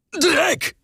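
sharp high curse spat out; cursing. Acted. Hubert curses the agile beast, sharp high shout. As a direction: swear at the dodging beast. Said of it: "fluchte Hubert".

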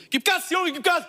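high mock yell with a laugh; shouting operator. Operator shouts at the kid again, high and laughing. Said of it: "immer so".

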